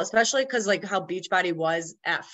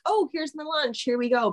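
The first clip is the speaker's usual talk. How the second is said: higher, light sing-out to followers; mock chirpy; 9 semitones higher than usual. Showing followers her lunch, mock chirpy. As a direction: play at cheerfully showing off lunch.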